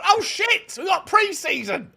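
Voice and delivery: sudden high yelp of mock alarm; playing the club waking up in a panic. Fans mocking the club in a fake panicked yelp.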